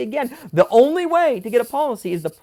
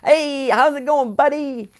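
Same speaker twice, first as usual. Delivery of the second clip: higher, slow, mock-cheerful greeting; butter him up with fake cheer; imitating chipper dad; 6 semitones higher, slower than usual. Son mimics dad calling him all chipper, higher and slower. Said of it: "he's calling me all chipper".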